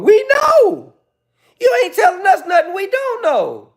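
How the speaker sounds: high, loud shouted retort; high loud mock yell at a commenter. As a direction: yell it back at her, hammed up.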